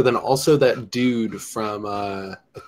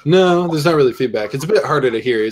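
ordinary talk, nothing marked

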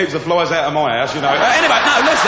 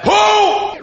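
much higher, loud call; shouted name; 4 dB louder than usual. Retold or imitated. Someone in the crowd calling to him, shouted. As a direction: yell the name from a distance. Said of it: "someone shouted out".

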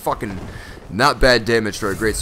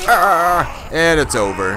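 usual speaking voice

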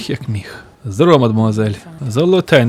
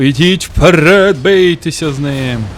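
bossy commander; 6 dB louder, slower than usual. Pretend. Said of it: "типу, вами керую".

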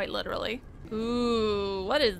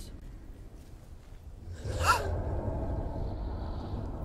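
sharp high frightened gasp; scared gasp; 10 semitones higher, 7 dB quieter than usual. Real, not acted. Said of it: "I'm getting scared at nothing".

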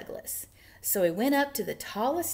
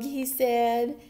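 usual speaking voice

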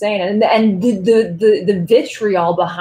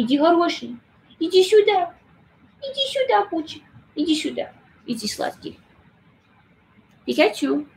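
tender coaxing pet talk in Russian, some words pitched way up; calling the cat in Russian; 3 semitones higher than usual. Real, not acted. Streamer coaxing her cat in Russian to come, tender pet talk. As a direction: sweet-talk the cat over, coaxing and lilting.

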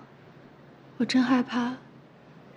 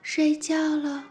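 slow, drawn-out, higher-pitched coaxing; soothing bedtime hush; 5 semitones higher, much slower than usual. Acted. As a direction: lull a baby to sleep, stretching the words. Soothing a child to sleep. (拖长声音轻柔哄睡)

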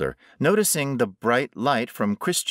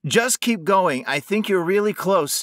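higher, raised, encouraging voice; urgent calling; 10 semitones higher, 4 dB louder than usual. A narrator's reading. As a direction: urge her onward carefully. Trapped man guides her closer.

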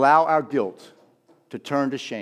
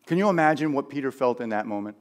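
ordinary delivery

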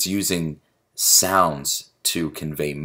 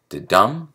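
"da-dum" with light da and long stressed dum; a single slow "da-dum", weight on dum; one iamb; 2 semitones higher, 5 dB louder than usual. Shown as a demonstration. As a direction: lean on the second beat of "da-dum". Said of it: "that's one set".

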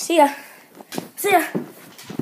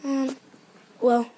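normal talking voice

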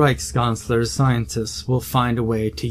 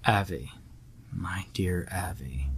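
soft, hushed and condescending; softer patronising tone to the scientist; as to a child; 4 semitones lower, 11 dB quieter than usual. Acted. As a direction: speak to him like a small child. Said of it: "as if we're talking to a child".

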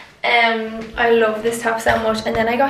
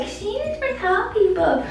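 mock high voice; 10 semitones higher than usual. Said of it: "And I know I was like".

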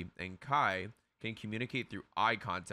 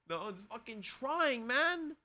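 high, exasperated rant; frustrated rant; 12 semitones higher than usual. Real, not acted. Streamer ranting that nobody on the team tries. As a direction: vent at the useless team.

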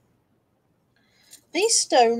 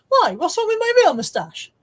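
mock offended; 10 semitones higher, 8 dB louder than usual. Mock offended at viewer joke.

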